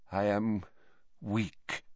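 put-on weak old man's near-breath. A narrator's reading. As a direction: barely voice it, exhausted. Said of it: "he said".

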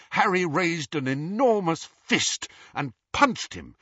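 usual speaking voice

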